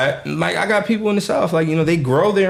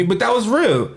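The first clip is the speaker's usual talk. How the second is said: ordinary talk, nothing marked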